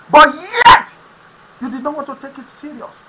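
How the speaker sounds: normal talking voice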